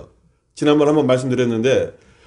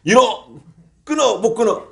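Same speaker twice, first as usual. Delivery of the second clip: mock shouting; 7 semitones higher than usual. Senior monk scolding himself at the tavern, imitated shouting. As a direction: act out a man crying out to himself. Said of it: "막 혼자 막 외치는 거야".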